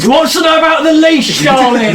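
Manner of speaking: put-on high, loud old gnome; shouting to wife. Old gnome explains to his wife, shouting high. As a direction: holler it for someone hard of hearing.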